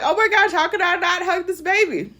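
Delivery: high, gushing delight. Retold or imitated. She gushes as the toddler charges at her, high and delighted. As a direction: squeal it over an adorable baby. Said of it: "And I'm like".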